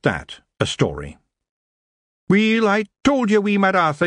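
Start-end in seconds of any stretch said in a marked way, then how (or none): 2.3-3.4 high, loud Scots scolding; a wee chieftain telling him off; Scottish pixie chief. Acted. Rob scolds in story. 3.4-4.1 high Scots with rolled burr on Mad; Scottish pixie chief. Acted. Said Rob.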